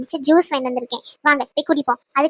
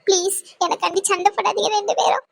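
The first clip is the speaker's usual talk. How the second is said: higher-pitched, upset begging; tearful plea; 4 semitones higher than usual. Acted. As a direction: beg them to stop, close to tears. Tearful girl begs them not to fight. (அழுகையோடு கெஞ்சல்)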